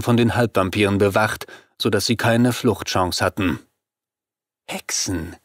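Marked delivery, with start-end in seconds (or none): 4.7-5.4 hushed, breathy whisper of one word; the vampire whispers Hexen to the captive women. A narrator's reading. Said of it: "flüsterte die Cavaglio".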